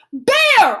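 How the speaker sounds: high, very loud cry for a friend; desperate scream. A narrator's reading. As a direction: scream after someone swimming away. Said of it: "I scream, gasping".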